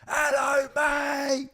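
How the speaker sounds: huge high, bellowed greeting; ear-splitting shout. Retold or imitated. Boss greeting his tired passenger at five a.m., shouting. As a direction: holler it loud enough to take someone's ears off. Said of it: "Like, fucking shouting the, like, ears off".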